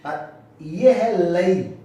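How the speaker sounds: लई said slowly as "la-ee", two vowels apart; drawn-out lai. Shown as a demonstration. Teacher to students, slow careful लई. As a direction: stretch लई into two sounds, slowly. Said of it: "बहुत minor अंतर है".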